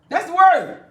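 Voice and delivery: loud, high proclamation; preaching shout. Real, not acted. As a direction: shout it to the church. Preaching climax.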